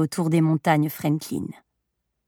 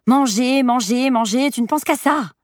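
higher-pitched, mildly scolding repetition; exasperated mother; 5 semitones higher, 3 dB louder than usual. A narrator's reading. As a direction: chide a child who only thinks of food. Mother chides her hungry little boy. (mère un peu exaspérée, voix haut perchée)

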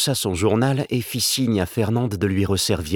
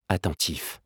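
ordinary delivery

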